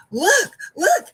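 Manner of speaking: high, loud calls to look; eager retold "look, look"; excited urging. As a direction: point out the action excitedly. Urging student to watch a fight.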